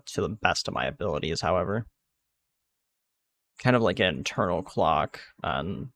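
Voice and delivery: usual speaking voice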